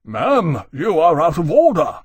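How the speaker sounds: loud, high, indignant rebuke; outraged snap. Acted. Musgrave outraged in his chair, snapping at Jane. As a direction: snap it out to silence her.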